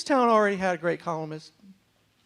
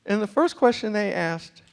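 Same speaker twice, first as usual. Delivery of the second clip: ordinary delivery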